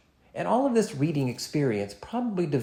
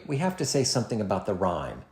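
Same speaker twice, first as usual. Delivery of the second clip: usual speaking voice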